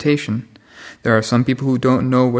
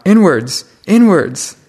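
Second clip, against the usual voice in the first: louder, higher one-word command; imitated shout; 6 semitones higher, 7 dB louder, slower than usual. Monk to novices sweeping the path, shouting. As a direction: call it out loud and high, falling away. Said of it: "stand there and shout".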